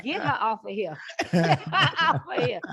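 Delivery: rising into laughter; podcast hosts laughing her off the show; laughing mock dismissal. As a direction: laugh while saying get her off. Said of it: "y'all would have been like".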